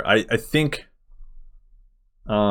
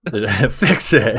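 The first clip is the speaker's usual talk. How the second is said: slow words through laughter; laughing; much slower than usual. Real, not acted. Host joking about backwards scheduling, laughing. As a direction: laugh while demanding it.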